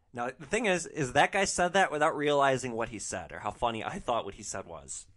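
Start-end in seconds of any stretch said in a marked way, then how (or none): none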